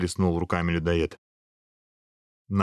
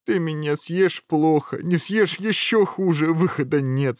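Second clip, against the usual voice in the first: high, slow, drawn-out whine; tearful despair; 8 semitones higher, 3 dB louder than usual. A narrator's reading. Prince seeing no way out with the ogre, tearful despair. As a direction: lament hopelessly, close to wailing. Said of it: "сказал принц и зарыдал".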